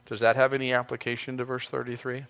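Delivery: usual speaking voice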